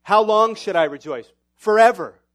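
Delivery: loud, ringing pulpit declaration; full-voiced sermon call to rejoice; preaching at full force. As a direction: proclaim it to the whole room.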